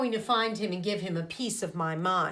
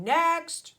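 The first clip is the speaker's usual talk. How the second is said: sharp high call of a gym teacher; 8 semitones higher, 5 dB louder than usual. Acted. Gym teacher calling the next kid to jump. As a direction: bark the next turn like a coach.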